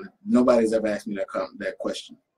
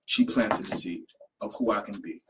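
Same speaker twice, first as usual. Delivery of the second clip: normal talking voice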